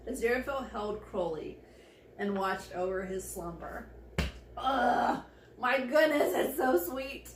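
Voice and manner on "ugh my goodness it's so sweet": loud, high delighted groan; can't-take-it delight; overwhelmed gushing. Real, not acted. Overwhelmed by the sweetness.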